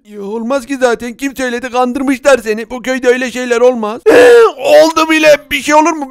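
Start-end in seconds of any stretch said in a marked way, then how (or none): 2.7-4.0 tearful cry, fast; crying shout. Acted. Shot villager wails at the seller who promised safety, tearful. Shot man cries out in protest. 4.1-6.1 higher and louder, tearful; crying shout. Acted. Wounded villager cries out that it already happened, tearful.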